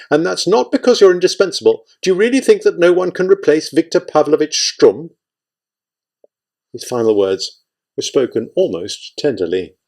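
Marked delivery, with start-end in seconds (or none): 0.1-5.1 stern, high-pitched challenge. A narrator's reading. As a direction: put him sternly in his place. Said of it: "Kovchenko said sternly".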